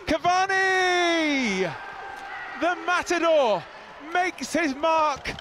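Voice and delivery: long, slow, loud, high roar of the name; goal roar. Real, not acted. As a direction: hold the name out in a full roar. Winning goal called live.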